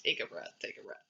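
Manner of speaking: breathy whisper to herself; whispered to self. Real, not acted. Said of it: "running out of breath".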